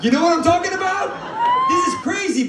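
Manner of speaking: high, loud, incredulous outburst; outraged shouting. Acted. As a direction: yell it at the crowd in mock outrage. Outraged punchline to the audience.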